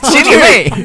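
loud, high mock outburst; mock angry. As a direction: snap it back in mock outrage. Repeat of mock outburst, laughing.

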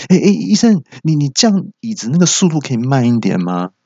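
patient panicking as the dental chair sinks; startled patient. Retold or imitated. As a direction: yelp as the chair drops. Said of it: "我就有点受到惊吓".

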